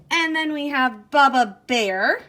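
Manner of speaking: ordinary talk, nothing marked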